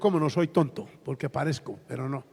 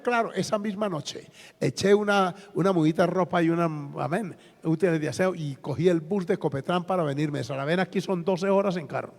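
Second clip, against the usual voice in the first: normal talking voice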